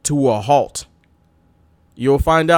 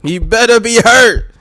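higher, louder and slow; imitating Rick Steiner; 7 semitones higher, 7 dB louder, slower than usual. Rick Steiner threatening the injured Buff, mock yell. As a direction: lean on it like a threat. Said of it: "That sound like".

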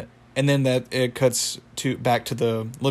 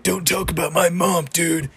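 higher-pitched indignant cartoon voice; Muscle Man; 6 semitones higher than usual. Muscle Man snaps back at the taunting wizard, mock indignant. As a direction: act out an offended retort in a put-on voice. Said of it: "he taunts Muscle Man".

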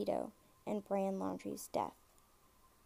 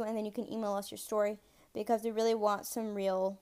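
usual speaking voice

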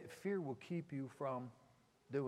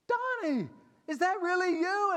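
put-on high, loud girlish voice, much higher than usual; girl's voice; 10 dB louder than usual. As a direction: play the excited young woman, high and bright. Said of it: "and she's like".

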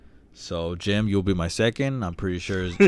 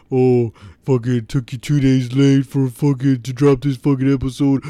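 loud, higher griping imitation; mocking Jim's complaint; 5 semitones higher, 8 dB louder than usual. Host mimicking a listener griping about a late episode, loud and mocking. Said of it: "because you were like".

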